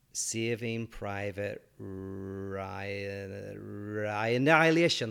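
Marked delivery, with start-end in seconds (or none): 0.1-3.5 slowly stretching each word while remembering; drawn-out recall. Real, not acted. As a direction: drag the title out as if searching memory.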